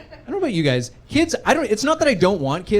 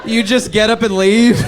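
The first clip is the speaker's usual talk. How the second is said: normal talking voice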